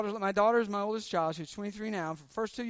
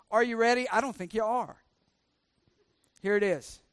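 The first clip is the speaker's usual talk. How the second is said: ordinary delivery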